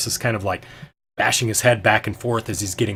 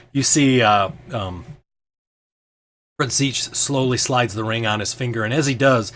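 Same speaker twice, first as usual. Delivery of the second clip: normal talking voice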